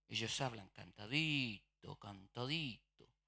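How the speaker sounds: slow, lilting Córdoba sing-song; sing-song Córdoba accent. Shown as a demonstration. Preacher demonstrating the Córdoba sing-song accent. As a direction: let Córdoba Spanish lilt as you drawl it out. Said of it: "Es como que estuvieran cantando".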